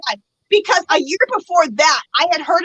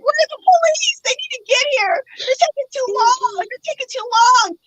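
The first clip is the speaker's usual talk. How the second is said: high, frantic pleading for the police; panicked plea; 8 semitones higher than usual. Retold or imitated. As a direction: beg for help, higher and tense. Panicked call waiting for police.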